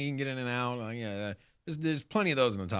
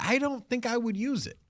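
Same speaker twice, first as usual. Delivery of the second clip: pitched-up skeptical voice; high doubtful self; 13 semitones higher than usual. Retold or imitated. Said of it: "my first feeling is like".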